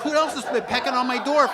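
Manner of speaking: high, loud cartoon bird; bird's smug voice. Bird Mary teasing the narrator, high loud cartoon voice. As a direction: squawk it like a smug little bird. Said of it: "It's like".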